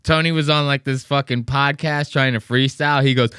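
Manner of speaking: usual speaking voice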